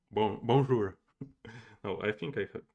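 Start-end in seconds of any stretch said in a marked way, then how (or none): 0.1-1.0 a louder, more French "bonjour". Shown as a demonstration. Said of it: "can do a better French accent".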